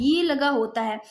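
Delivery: normal talking voice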